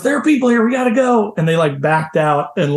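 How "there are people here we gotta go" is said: urgent alarm. Retold or imitated. Man spots campers, flees.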